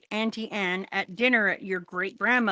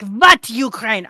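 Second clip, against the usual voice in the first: put-on loud, slow, Eastern European accent; old auntie's retort; 11 dB louder, slower than usual. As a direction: bark it like an old Romanian auntie. Old auntie voiced, retold.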